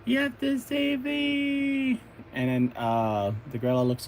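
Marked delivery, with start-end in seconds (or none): 0.0-2.0 very high, drawn-out mock plea; hammed-up princess begging for rescue; damsel's plea. Princess pleads to be saved.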